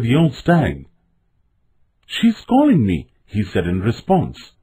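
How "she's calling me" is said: put-on high, eager young deer; high-voiced insistence; eager protest. A narrator's reading. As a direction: protest eagerly, pitched well up. Bambi pleads to follow the call.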